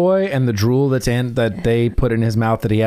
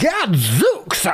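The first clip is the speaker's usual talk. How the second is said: high, hammed-up cry of the word "Gadzooks"; mock excited exclamation; 9 semitones higher than usual. Replaying the professor's line to friends, mock excited cry. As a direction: throw "Gadzooks" out like a thrilled old wizard. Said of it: "Dude, the Gadzooks".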